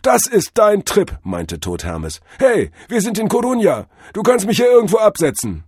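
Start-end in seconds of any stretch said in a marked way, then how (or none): 0.0-1.2 very high, loud guru warning; jive-talking drug-vision god. A narrator's reading. God advises Cordell against overthinking, high loud guru. Figure of Tod Hermes. 2.4-5.6 very high, loud guru warning; jive-talking drug-vision god. A narrator's reading. God advises Cordell against overthinking, high loud guru. Figure of Tod Hermes.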